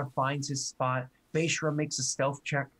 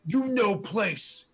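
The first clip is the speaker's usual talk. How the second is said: slow, higher, louder orc growl; orc shoving; 6 semitones higher, 4 dB louder, much slower than usual. Acted. Orc alpha shoves orc beta and warns him. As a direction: put him in his place. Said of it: "And he's like".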